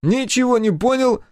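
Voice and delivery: Step's voice. A narrator's reading. Step butting into the interrogation, cheeky high voice. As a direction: pipe up like a nosy young sidekick. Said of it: "прокомментировал Степ".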